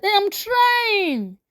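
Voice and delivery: high, drawn-out protest; exasperated protest. A narrator's reading. Adewale defends himself in a money quarrel, exasperated.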